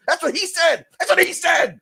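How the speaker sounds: high, emphatic protest; indignant insistence. Real, not acted. Host insisting on the wrestler's own words, indignant.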